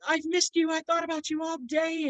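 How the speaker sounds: very high, gushing dog voice; happy dog. Acted. Adoring dog welcomes its owner home, happy high voice. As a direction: gush like a devoted pet who waited all day. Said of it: "the dog is so happy to see you".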